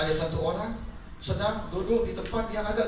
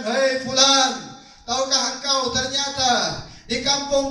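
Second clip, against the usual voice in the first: divine voice; 5 semitones higher than usual. Acted. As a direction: announce it like a voice from heaven. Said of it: "ada suara yang mengatakan". (suara gaib yang lantang dan lambat)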